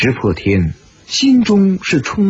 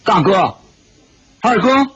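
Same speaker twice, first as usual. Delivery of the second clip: mild call, rising on the second name; 4 semitones higher than usual. A narrator's reading. Puzzled Shi Potian to his sworn brothers, calling out. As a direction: call to two companions without much force. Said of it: "只叫了一声".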